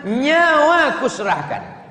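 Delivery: high, loud lover's vow; the devoted husband pledges his life, loud and high; passionate vow. Acted.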